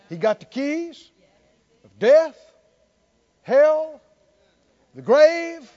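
rising preaching climax. Real, not acted. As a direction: hammer each item harder. Preacher builds to a rousing climax.

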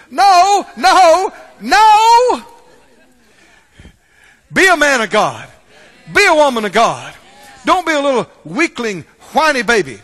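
loud, emphatic pulpit exhortation; forceful preaching. Real, not acted. Preacher rejecting excuses before the church, forceful and loud. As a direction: thunder it at the congregation.

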